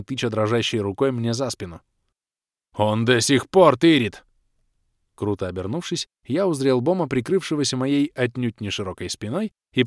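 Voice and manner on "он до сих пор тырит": loud, slow accusing cry; indignant shout. A narrator's reading. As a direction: shout it, pointing. Рыцарь кричит, тыча рукой. (громко и возмущённо)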